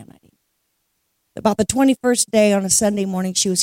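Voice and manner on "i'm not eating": "I'm not eating" breathed as a whisper; whispered denial. Retold or imitated. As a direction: let it fade into a whisper. Wife keeps denying she's fasting.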